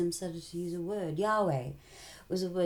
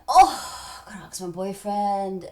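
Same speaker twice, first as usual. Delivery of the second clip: excited Eve; 2 semitones higher, 11 dB louder, slower than usual. Acted. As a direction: gasp it out, giddy. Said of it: "Eve's like".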